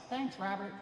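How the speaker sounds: high, soft mom aside; mother's voice. Retold or imitated. Mom thanking her obedient son, soft and high. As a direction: slip in softly as a pleased mother. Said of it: "I held to my mom's advice".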